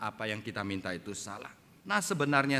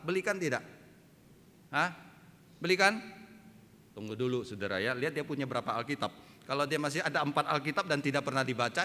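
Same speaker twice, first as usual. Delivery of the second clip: ordinary talk, nothing marked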